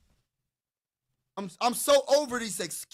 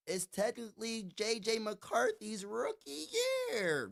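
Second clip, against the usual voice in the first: mock Vikings fan excuse; 3 semitones higher, 6 dB quieter, slower than usual. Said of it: "some Viking fans are probably gonna say".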